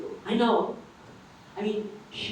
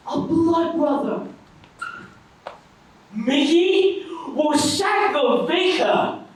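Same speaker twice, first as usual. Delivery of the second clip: loud, high-pitched cry of recognition; excited shout; 7 semitones higher, 5 dB louder, slower than usual. Acted. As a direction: call out your friend's name in delight. Excited reunion of two friends.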